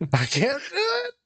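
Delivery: high, slow, wailing "I can't do it"; half-joking wail over a sad scene; crying out. Real, not acted. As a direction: let the voice break high as if overcome. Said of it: "That was so sad".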